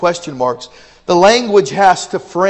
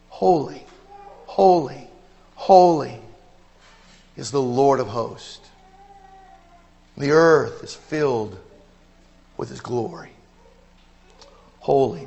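hushed reverence; 4 semitones lower, 5 dB quieter than usual. Acted. Preacher quietly reciting in reverent awe. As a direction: breathe the words in awe.

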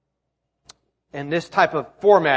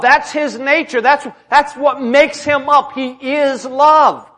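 higher, slow, weighty declarations; impassioned preaching; 6 semitones higher, 6 dB louder, slower than usual. Real, not acted. Preacher telling congregation God is love, impassioned. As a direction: drive the conclusion home.

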